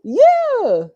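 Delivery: put-on high sing-song voice for a toddler; baby talk. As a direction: coo it up high to the little one. Talking to the baby.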